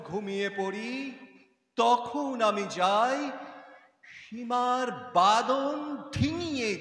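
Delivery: expressive verse, stretched words swelling upward; poem recitation. Acted. Girl tells the cloud of her dreams, rising poetic recitation. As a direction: recite it slowly, lifting into the dream.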